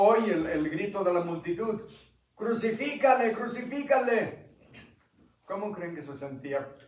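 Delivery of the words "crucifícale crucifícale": much higher, each word falling; mob shout. Acted. Multitude heard from the cell demanding crucifixion, high shout. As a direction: cry it out like a frenzied crowd.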